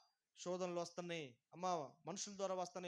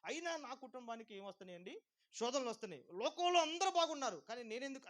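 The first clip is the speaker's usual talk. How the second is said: rising to a higher, louder wail of why me; indignant complaint; 7 semitones higher, 10 dB louder than usual. Retold or imitated. Imitating a believer complaining to God, indignant. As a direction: plead like a wronged believer.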